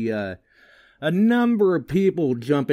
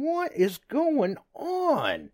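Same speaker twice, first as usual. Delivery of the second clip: bewildered; much slower than usual. Retold or imitated. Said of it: "and I'm going".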